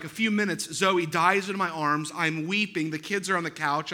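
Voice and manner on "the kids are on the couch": ordinary talk, nothing marked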